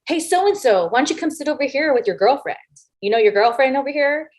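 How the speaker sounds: high, sing-song taunt across a room; needling call-out. Retold or imitated. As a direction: call over sweetly to provoke him. Needling wrestler across the catering room.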